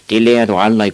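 loud scoffing jeer; scornful boys. A narrator's reading. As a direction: dismiss him loudly.

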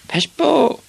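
put-on very high boy's cry; high-pitched child's yell; screamed warning. A narrator's reading. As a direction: shriek a drawn-out warning. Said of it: "skreg Ivan".

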